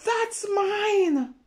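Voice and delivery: high loud possessive yell; snatch it back with your voice; angry shout. Acted. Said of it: "His brother says".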